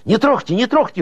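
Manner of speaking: high-pitched cry of warning; urgent shout. A narrator's reading. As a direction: cry out high to stop them. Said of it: "Шухов закричал".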